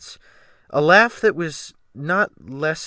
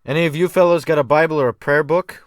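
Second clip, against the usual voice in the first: loud demand; 5 semitones higher, 7 dB louder, faster than usual. A narrator's reading. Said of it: "was the captain's next demand".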